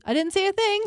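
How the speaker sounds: mock-nervous quote, pitched high; high, laughing take on a nervous denial; nervous imitation. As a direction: imitate a nervous man, giggling as you go. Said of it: "that so nervously".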